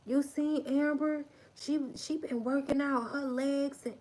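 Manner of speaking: high, excited gossip voice; gossiping acquaintance. Retold or imitated. Gossiper hyping Amber's workout to the crowd. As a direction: gush about Amber's new body. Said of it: "These people could have caught you".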